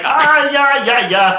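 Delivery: a bit Kermit-like cartoon glove; mascot glove voice. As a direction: sing it out like a goofy mascot. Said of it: "And he's like".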